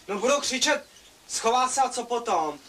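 he shouts back at mum a bit; mock shout. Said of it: "když na tebe zakřičím". (hlasitější vzdorovitá odpověď)

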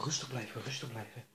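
ordinary delivery

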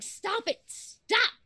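sharp high yell of stop; protesting shout. Acted. Patient yelling at nurses to stop wheeling her. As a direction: shout to be let go.

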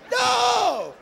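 high, loud imitated bawl; crying wail. Brother crying outside church, wailing in protest. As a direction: wail it like a snotty, sobbing kid. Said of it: "my brother's crying".

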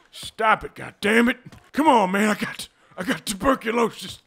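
high, hammed-up begging; mock-panicked cowboy plea; pleading. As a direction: beg your attacker to stop. Beaten outlaw begs for mercy.

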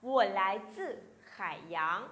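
slow, careful Mandarin question; slow, syllable by syllable Chinese demo; slow model reading. Shown as a demonstration. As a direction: read it out slowly for students to copy. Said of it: "mình muốn nói".

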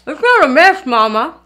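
talking through a mouthful of biscuit; mouth full. Shown as a demonstration. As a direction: say it round a bite of food. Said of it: "I'll try pretending like I'm eating biscuit".

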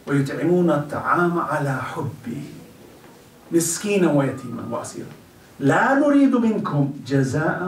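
rapid, flowing chant of the Arabic verse; Quran recitation. Acted. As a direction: recite the verse quickly in one breath.

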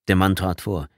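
usual speaking voice

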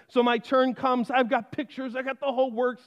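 normal talking voice

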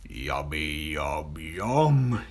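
low crocodile voice, savouring; greedy crocodile. A narrator's reading. Crocodile watching the little girl approach, low greedy voice. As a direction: relish the coming meal. Crocodile thinking of eating Jill.

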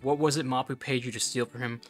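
normal talking voice

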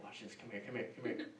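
whispered, beckoning a friend over; playful secret whisper; conspiratorial whisper. Retold or imitated. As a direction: hush someone over to see the prank. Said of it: "I imagine he was up there going".

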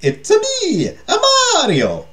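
very high, drawn-out cartoon Italian accent; over-the-top Italian catchphrase voice; Mario. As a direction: squeal it in a hammy Italian accent. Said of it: "an Italian accent. Other than, like".

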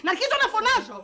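rapid, worked-up ranting; hysterical outburst. Acted. Actress told to calm down, threatens to walk out, hysterical. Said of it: "ηρεμήστε".

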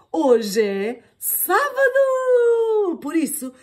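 high, loud, slow cheer; rally them with glee; high, loud, drawn-out delight; excited. Acted. Mother's cheerful rallying cry.

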